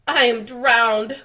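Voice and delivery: slow, loud gasping cry; gasped. Acted. The grasshopper after the deluge, slow loud gasping. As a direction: gasp it out, half drowned. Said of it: "gasped the old green grasshopper".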